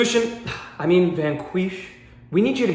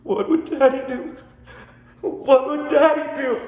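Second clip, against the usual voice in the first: ugly crying; 5 semitones higher than usual. Acted. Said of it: "ugly crying".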